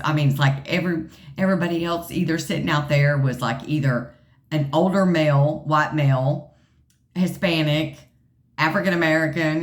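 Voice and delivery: usual speaking voice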